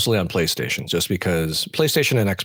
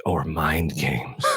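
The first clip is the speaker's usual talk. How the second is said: deadpan joke; 5 semitones lower, 4 dB quieter, much slower than usual. Retold or imitated. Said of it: "and then I joked".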